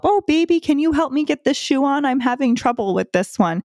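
put-on high cooing baby talk; mother asking her son for help; motherese. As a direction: sweetly ask the baby for help. Mother babying son with shoes.

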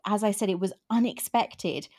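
normal talking voice

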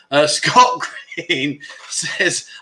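ordinary talk, nothing marked

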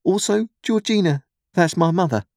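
put-on high-pitched nervous boy; timid explanation. A narrator's reading. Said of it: "I timidly explained".

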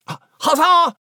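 a little creature bursting in to answer; Nigel cheering. A narrator's reading.

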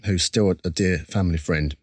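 ordinary talk, nothing marked

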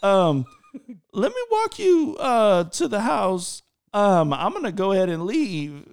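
hammed-up awkward exit after being rejected; flustered excuses. Acts awkward excuses to escape.

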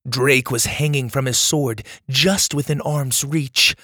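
usual speaking voice

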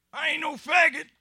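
loud, very high-pitched outburst; Todd. Acted. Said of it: "then I heard Todd say".

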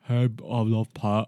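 slow, lightly voiced dim Geordie bloke; simple Geordie guy. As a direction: play it dopey and slow. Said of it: "Everything else is like".

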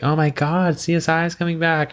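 high, gushing fake thrill; mock excitement. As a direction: squeal it like a surprise party. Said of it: "and to be like".

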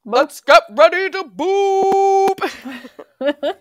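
high, loud, slow ring-announcer call; boxing announcer. Acted. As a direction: stretch it out like a fight intro. Boxing-announcer style catchphrase.

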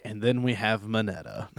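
softly, as if reluctantly reaching the name; imitated weary sigh. Teacher reaching Mineta near the list's end, sighing reluctance. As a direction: let it out on a resigned breath. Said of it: "takes a deep breath and sighs".

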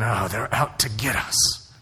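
low, slow mock-paranoid voice; mock paranoia. Christians at home fearing a plot, mock paranoia. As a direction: drag it out low, mimicking suspicion. Mimicking suspicious Christians' thinking.

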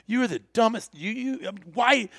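exasperated, stammering imitation of a scolding; retold telling-off. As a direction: chew him out, lost for words. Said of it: "I'm just chewing his hind parts".

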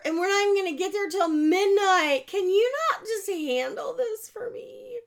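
high, loud, tearful whine; whining. Retold or imitated. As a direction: beg like a spoiled child close to tears. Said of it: "This is my whining".

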